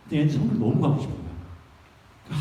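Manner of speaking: usual speaking voice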